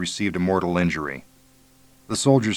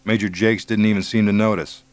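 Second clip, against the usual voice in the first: plain reading voice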